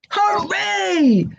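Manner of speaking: high happy cheer; shouted. A narrator's reading. Crow cheering over the corn deal, high glad cry. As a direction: throw up a delighted cheer. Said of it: "shouted the crow".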